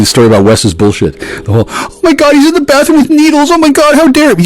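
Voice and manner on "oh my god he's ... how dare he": very high, shrieky mock outrage; high-pitched mock horror; mocking hysterical imitation. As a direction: mimic a scandalized accuser way up high. Mimics her hysterical accusation.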